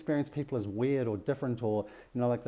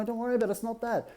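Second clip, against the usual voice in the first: high-pitched, dismissive self-reassurance; acted frantic reassurance; 10 semitones higher, faster than usual. Intellect soothing the scared self, high and insistent. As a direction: brush the fear away in a played-up high voice. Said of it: "So no matter how much the intellect goes".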